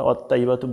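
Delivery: quick "ওয়াত্তাইবাতু" with light "tta"; written Bangla reading. Shown as a demonstration. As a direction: say "ওয়াত্তাইবাতু" as wat-ta-ibatu, unstretched. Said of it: "বলবো না, আমরা তোয়া দিয়ে বলবো". (পাতলা তা দিয়ে ভুল উচ্চারণ)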